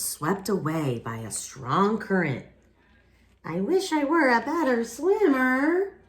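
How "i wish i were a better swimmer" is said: higher, wistful little narwhal voice; a small hopeful wish, pitched up; Kelp wishing. Acted. Teacher voicing Kelp wishing he swam better, higher and wistful.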